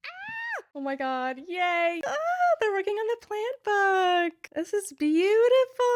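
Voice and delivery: high, delighted squealy gushing; excited gushing. Real, not acted. Fan flipping through illustrations to viewers, delighted high gush. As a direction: coo over each picture with delight.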